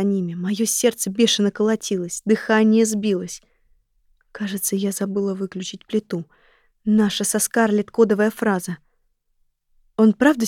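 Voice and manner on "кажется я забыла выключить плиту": unvoiced secret whisper. Real, not acted. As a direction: breathe the code phrase in a hush. Code phrase recalled in thought.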